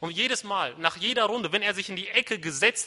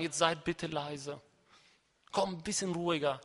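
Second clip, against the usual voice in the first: half-hearted quiet plea for calm; soft request; 6 dB quieter than usual. Acted. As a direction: ask the class timidly and softly. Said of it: "wird der Lehrer ja auch nicht sagen".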